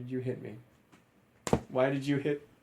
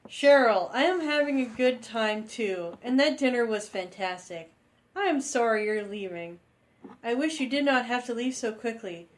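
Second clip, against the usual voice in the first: put-on girly Cheryl voice; 10 semitones higher than usual. Reading Cheryl's dialogue in voice.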